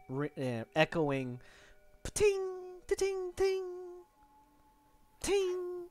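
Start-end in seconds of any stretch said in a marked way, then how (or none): 2.0-5.8 high soft tinging, like a far-off anvil; distant ringing. Retold or imitated. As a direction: sing the pings high and soft. GM imitates distant forge ringing.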